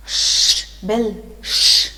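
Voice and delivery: long, forceful shushing; urgent acted hush; whispered hushing. A narrator's reading. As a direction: shush him sharply around his name. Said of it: "Wagon whispered".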